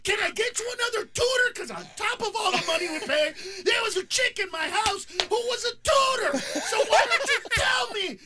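high, loud furious mock rant; exasperated dad yelling at his anxious son; snapping, yelling dad. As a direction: scream it at your kid in the car. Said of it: "And I just snapped".

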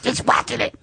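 very high, squeaky buddy voice; monkey voice. Acted. Talking to his friend Munchak in their monkey voice. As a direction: chatter like a cartoon monkey. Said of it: "full conversations in this voice".

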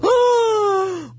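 high, drawn-out starstruck cry; retold shriek of awe, stretched out; starstruck gasp. Guest reliving spotting [name], starstruck.

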